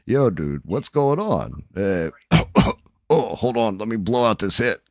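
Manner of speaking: imitating a buddy coughing on a hit; Smurf impression. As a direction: play your pal answering mid-smoke. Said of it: "when I call Smurf up or whatever".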